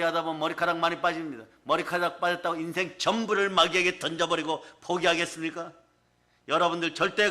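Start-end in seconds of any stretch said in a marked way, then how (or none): none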